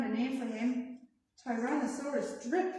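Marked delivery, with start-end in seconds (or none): none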